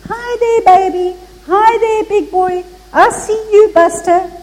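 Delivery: put-on high, sing-song baby talk from an old man; a retold drunk's high baby-talk greeting; cooing old drunk. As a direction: coo up high at the baby like a tipsy geezer.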